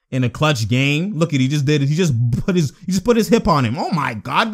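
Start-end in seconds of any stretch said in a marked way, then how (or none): none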